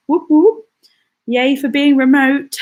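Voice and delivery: high-pitched little celebratory whoop; playful cheer. Real, not acted. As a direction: cheer it brightly, pitched right up. Cheers for working from home.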